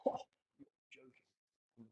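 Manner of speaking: slow whispered British disbelief; British accent. Retold or imitated. British coach answering pupil's question, whispered British accent. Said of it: "such a British accent".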